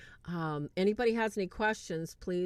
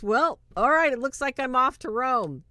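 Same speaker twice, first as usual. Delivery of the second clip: higher and louder, thrilled about going to Rome; excited; 5 semitones higher, 7 dB louder than usual. Retold or imitated. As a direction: say it like you just got great news. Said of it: "And he was so excited".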